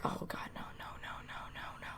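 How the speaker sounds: whispered string of pleading no's; retold inner panic under the breath; whispered dread. Storyteller acting out dread at sitting by the girls, whispered.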